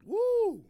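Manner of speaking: loud high woo after a strong sip; whoop after a drink. Real, not acted. Podcaster whooping after sipping yak, loud and high. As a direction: let out a big woo as the liquor hits.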